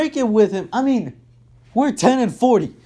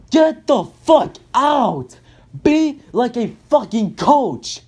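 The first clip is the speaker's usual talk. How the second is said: furious shout; 3 semitones higher, slower than usual. Real, not acted. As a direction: bark him out of the building. Shouting at coach Fizdale.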